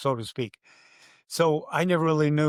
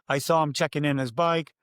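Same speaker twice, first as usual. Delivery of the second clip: usual speaking voice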